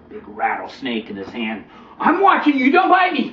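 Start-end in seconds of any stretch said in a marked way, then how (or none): 2.0-3.3 put-on high, loud wary kid; boy warning the snake he is watching it, high and loud. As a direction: warn him like a cautious child.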